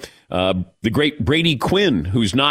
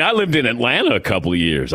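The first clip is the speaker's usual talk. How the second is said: ordinary talk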